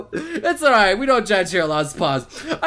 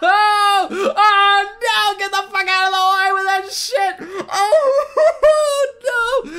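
horrified outburst; 7 semitones higher, much slower than usual. Real, not acted. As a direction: cry out in horrified disbelief. Walks in on Miku changing.

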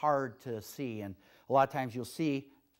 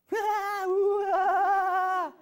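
giddy laugh; 8 dB louder than usual. Real, not acted. Laughing at her own Friday energy.

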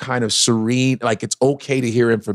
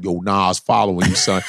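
low flat troll voice; 6 semitones lower than usual. Retold or imitated. Troll repeating message.

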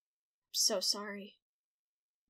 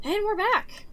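excited; 11 semitones higher, 8 dB louder than usual. Real, not acted. Returning to the game scene.

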